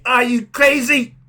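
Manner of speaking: loud, high, drawn-out disbelief; exasperated outburst. Real, not acted. Ranting at people expecting change, exasperated shout. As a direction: shout it at someone who's lost their mind. Ranting at hopeful New Year believers.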